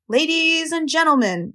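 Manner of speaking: slow, high, drawn-out crowd address; stretched fairground announcer's greeting; loudspeaker announcer. A narrator's reading. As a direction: grandly open the ceremony as announcer. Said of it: "said the loudspeaker".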